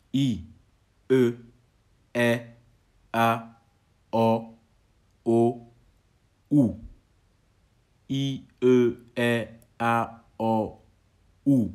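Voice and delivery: each vowel sounded alone between pauses; vowel demo. Shown as a demonstration. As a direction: recite the vowels one by one, firmly. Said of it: "Les voyelles sont". (voyelles prononcées une à une, bien détachées)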